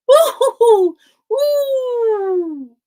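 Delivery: high, loud owl hoot. Acted. Owl accepts the invitation with loud hoots.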